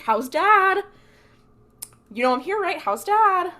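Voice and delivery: high, loud retold questioning; hammed-up plea for an answer; insistent demand. Unheard Dean demands an answer.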